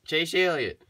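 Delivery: sudden high, loud holler of the name; meme-style name call. Pretend. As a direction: belt out the name as a running gag. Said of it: "Oh, I did it again".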